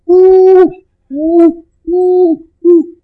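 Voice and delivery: high, loud swooning oohs; mock ecstatic oohs. Preacher mocking a dazzled crowd with high oohs. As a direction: hoot like a crowd dazzled by wonders.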